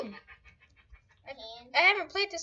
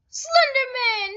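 high, loud, drawn-out exclamation; excited cry; 9 semitones higher, 8 dB louder, much slower than usual. Real, not acted. Player spotting a favorite scary character, excited cry. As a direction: call it out in delighted recognition.

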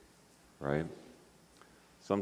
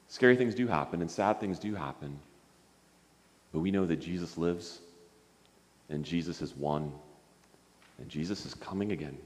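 put-on calm the frightened children, quieter each line; reassuring; 5 semitones lower, 7 dB quieter than usual. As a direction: lower and softer, fading down to a gentle close. Reassuring children, ending sermon.

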